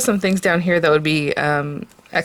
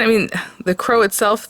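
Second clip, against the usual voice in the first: ordinary delivery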